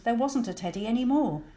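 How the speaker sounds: ordinary delivery, nothing marked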